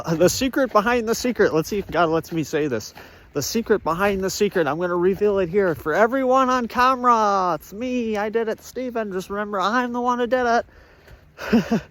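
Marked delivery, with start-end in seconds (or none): 0.0-1.5 dramatic reveal. Pretend. 3.3-4.7 dramatic reveal. Pretend. 4.7-11.8 high, slowing mock-sinister confession; God confessing. Vlogger voicing God confessing on camera to Stephen.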